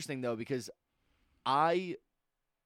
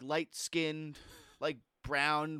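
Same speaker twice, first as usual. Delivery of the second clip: ordinary talk, nothing marked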